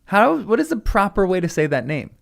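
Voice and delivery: usual speaking voice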